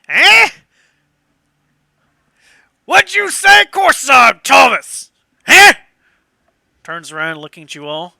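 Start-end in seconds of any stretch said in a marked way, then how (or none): 0.1-5.7 put-on high, loud old boatman's shout; confused old man. Old boatman answering a telepathic question, shouting in confusion. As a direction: holler back like a baffled old man. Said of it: "his lack of understanding, goes".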